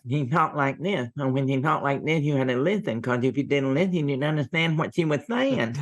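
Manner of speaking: mock lisp, "this" said as "thith"; imitating a lisp. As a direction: lisp the words like the short old aunt. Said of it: "she spoke with a lisp".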